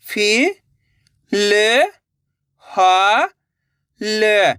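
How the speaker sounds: फि, ल, हा, ल drawn out with gaps; syllable by syllable. Shown as a demonstration. Teacher breaking a word for learners, syllable by syllable. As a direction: sound out फि, ल, हा, ल singly. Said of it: "एक अक्षर का उच्चारण करके".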